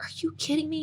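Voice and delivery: whispered disbelief. Retold or imitated. Retelling her shocked reaction.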